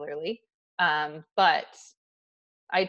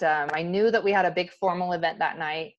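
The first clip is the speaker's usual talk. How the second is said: normal talking voice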